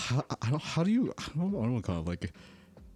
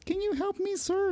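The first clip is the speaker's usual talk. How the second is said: put-on much higher, squeaky kid voice. Student asking the IT guy for help, high kid voice.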